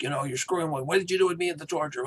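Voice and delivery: high, fast and frantic. Retold or imitated. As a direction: blurt it out in a panic. Tyler confronts T'Rell.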